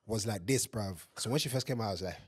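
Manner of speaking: ordinary delivery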